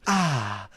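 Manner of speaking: low contented ahh of relief; relieved sigh. Shown as a demonstration. Showing the crowd the sound you make peeing, relieved ahh. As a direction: let out a long relieved ahh. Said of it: "that's the sound you make when you pee".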